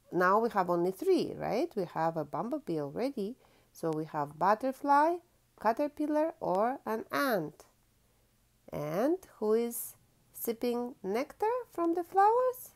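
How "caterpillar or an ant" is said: ordinary delivery, nothing marked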